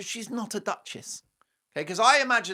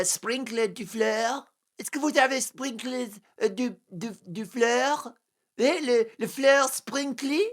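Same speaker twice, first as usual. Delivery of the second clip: higher, hammed-up bad French accent; mock French; 8 semitones higher, 3 dB louder than usual. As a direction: mangle French like a clueless tourist. Mock Meghan speaking bad French.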